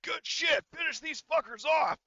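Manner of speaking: high, loud shouted order; rousing battlefield shout; barked command. Acted. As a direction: yell it like a commander urging troops on. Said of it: "he will first give a command".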